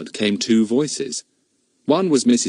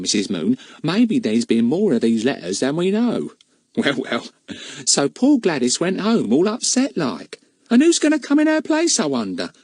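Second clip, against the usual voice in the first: cleaning woman's voice; 9 semitones higher than usual. Acted. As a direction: warn her knowingly. Mrs Cockles gossiping.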